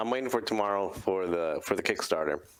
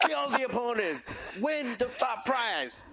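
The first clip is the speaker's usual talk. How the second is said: WWE hype man; 14 semitones higher than usual. Pretend. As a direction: pump up the crowd like a ring announcer. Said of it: "we need to send you to W W E hype man, boot camp".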